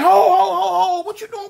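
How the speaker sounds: high, loud crying shout. Retold or imitated. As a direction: yell at her to stop. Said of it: "I'm like".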